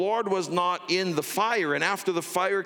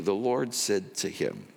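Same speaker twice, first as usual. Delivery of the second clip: ordinary delivery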